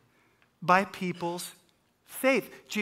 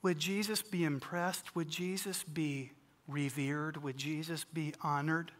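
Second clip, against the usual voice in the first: quiet questions; 4 semitones lower, 7 dB quieter than usual. Real, not acted. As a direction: let each question sink in softly.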